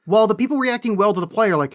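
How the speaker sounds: ordinary talk, nothing marked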